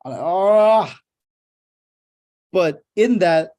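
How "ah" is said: a loud, high, anguished groan of reluctance; pained groan. Retold or imitated. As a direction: groan as if asked to do the unthinkable. Pained reaction to boss's request.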